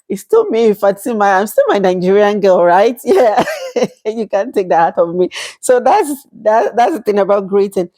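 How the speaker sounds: usual speaking voice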